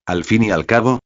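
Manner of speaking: ordinary delivery, nothing marked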